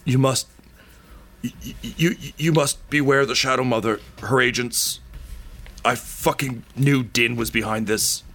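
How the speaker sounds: dying man warns the queen of the Shadow Mother; dying warning. Acted. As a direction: force the warning out with failing breath. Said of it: "and he's like".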